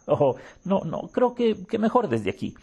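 high, shaken refusal; nervous shudder. Acted. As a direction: back away with a shiver. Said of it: "contestó la presentadora con un estremecimiento".

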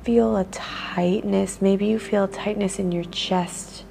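guided meditation. Acted. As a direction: ease the listener into noticing their body. Leads a body-scan meditation.

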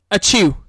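loud mock sneeze Achoo; fake sneeze. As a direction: burst out a hammy sneeze.

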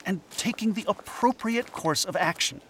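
normal talking voice

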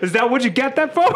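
high, incredulous question through laughter; laughing disbelief. Retold or imitated. Retelling a comeback to a coworker, laughing in disbelief. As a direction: retell it while cracking up.

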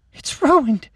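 high, loud despairing wail; girl seeing her shattered ankle, wailing high. Acted. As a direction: let it break into a wail. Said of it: "wailing in despair".

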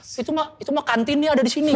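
high-pitched imitation of an MC's jibe; retold teasing. Retelling an MC ribbing teachers, high and mocking. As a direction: lift the voice into a teasing aside. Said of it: "Guru tuh dicengin fisik semua".

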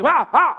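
high, loud mock roar of rage; angry yell sound. Pastor mimicking his angry wife's outburst. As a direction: let out a shrill furious ah. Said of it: "Pero brava, así".

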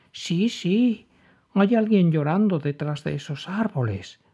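high, chirpy little bird. A narrator's reading. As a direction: answer like a small excited bird. Bird Pipo answers.

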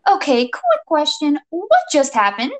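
high, cracking-up question; slightly squeaky bewildered question; prepubescent squeak. A narrator's reading. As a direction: push the voice up like a panicked youngster. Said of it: "hits a note that you haven't heard since before puberty".

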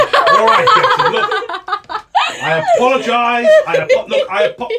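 high, loud, laughing wizard. Acted. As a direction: break up laughing.

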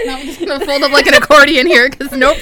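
loud, fast ramble through laughter; laughing. Real, not acted. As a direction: keep talking while cracking up. Laughing after a mishap.

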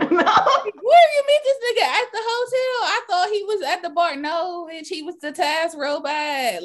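very high, loud, laughing disbelief; shocked gossip. Pretend. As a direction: squeal like a shocked friend. Acting a gossiping friend's reaction.

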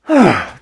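a loud, heavy tired sigh; weary sigh. Retold or imitated. Waking up tired.

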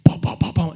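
loud percussive thumps; imitated hard patting. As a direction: fire off four explosive booms. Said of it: "used to be too aggressive".

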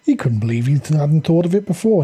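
usual speaking voice

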